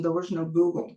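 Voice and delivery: normal talking voice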